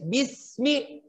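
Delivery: bismi said softly with a thin, light s; thin sin. Shown as a demonstration. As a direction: bismi with lips drawn back, thin s. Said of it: "silakan tarik bibirnya".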